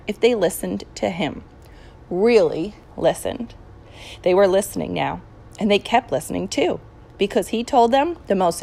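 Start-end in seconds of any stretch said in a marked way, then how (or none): none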